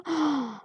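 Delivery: sharp audible intake of breath; shocked gasp. Acted. Reacting to the prisoner's French slip, shocked gasp. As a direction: gasp at the revelation.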